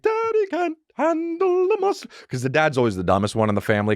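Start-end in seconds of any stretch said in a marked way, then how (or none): 0.0-2.1 very high, slow falsetto; mock a mom in a toddler show; cartoon mom. Cartoon mom comments on dad's mess, mocking falsetto. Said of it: "and the mom's like".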